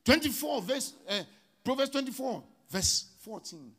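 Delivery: high, loud chanted preaching over music; preaching at full force. Acted. Pastor to congregation, loud chanted preaching. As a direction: lift the congregation with a sung cry.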